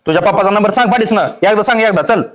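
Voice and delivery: loud, fast interrogation; threatening demand. Acted. Kidnapper demands the boy's father's number, threatening. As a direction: fire it out loudly and quickly.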